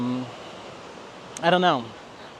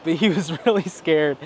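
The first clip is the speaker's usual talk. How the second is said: normal talking voice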